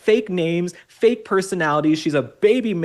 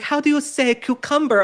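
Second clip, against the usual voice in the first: high mock Spanish accent; pitched-up parody Spanish accent; [name]'s put-on accent; 7 semitones higher than usual. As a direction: imitate a fake Spaniard hunting for the word. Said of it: "She's like".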